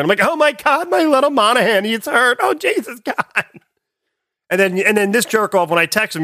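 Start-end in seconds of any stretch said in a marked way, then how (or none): 0.2-3.4 high, frantic worried-parent voice; hammed-up worried parent wail; concerned parent. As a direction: fret like a panicked mom over a hurt kid. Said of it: "I turned into concerned parent".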